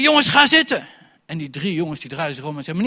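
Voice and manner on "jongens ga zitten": high, loud command to sit; stern order. Retold or imitated. Teacher ordering the boys to sit, loud and stern. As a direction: order the class back down.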